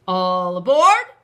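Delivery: loud, drawn-out call; booming train-conductor call; conductor cries out. Acted. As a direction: call out all aboard down the platform. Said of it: "the conductor cried out".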